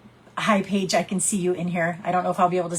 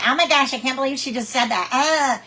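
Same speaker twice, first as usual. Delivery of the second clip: mock amused sister, a bit higher; teasing sister; 5 semitones higher than usual. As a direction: tease like a laughing sister. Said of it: "your sister's gonna laugh at you and be like".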